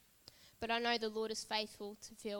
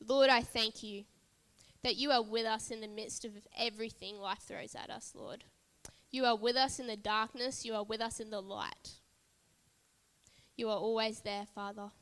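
louder, fervent spoken prayer; earnest congregational prayer; 4 dB louder than usual. Real, not acted. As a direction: pray over the people with fervour. Leads a prayer.

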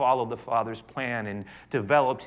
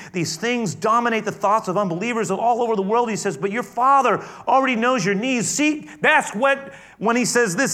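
loud, high, driving sermon voice; full-force preaching; 10 semitones higher, 9 dB louder than usual. Acted. Pastor preaching to his congregation, full force. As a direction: preach it to the back row.

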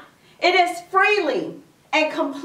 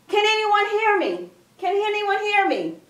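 mock scream; 5 semitones higher than usual. Jack hoping someone hears him, mild mock scream. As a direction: call out high, acting stranded. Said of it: "So he began to scream".

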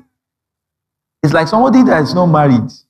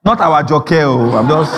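ordinary talk

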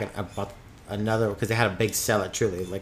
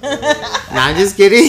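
laughing; 9 semitones higher, 12 dB louder, slower than usual. Real, not acted. Laughing after teasing joke.

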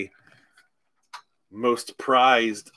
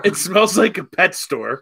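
disgusted shout; 5 semitones higher, 5 dB louder than usual. Real, not acted. Disgusted tasting exclamation.

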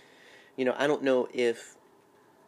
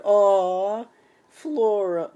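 high, gushing "aw" in mock delight; mock emotional gush; 9 semitones higher, 9 dB louder, much slower than usual. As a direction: coo it like a swooning fan. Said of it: "It's like".

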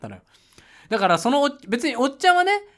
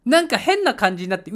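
usual speaking voice

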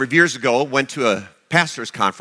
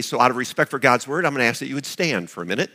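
normal talking voice

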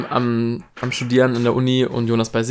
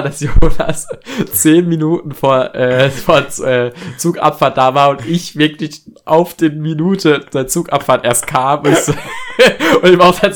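laughing; 3 semitones higher, 6 dB louder, slower than usual. Real, not acted. Laughing at own lateness.

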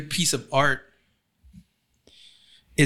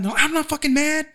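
high-pitched mock outburst of denial; angry denial. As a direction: act out someone indignantly insisting they're calm. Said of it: "people who get mad".

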